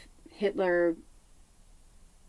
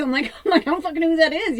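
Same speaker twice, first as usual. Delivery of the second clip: laughing; 9 semitones higher, 6 dB louder, much faster than usual. Retold or imitated. Laughing while retelling her reaction.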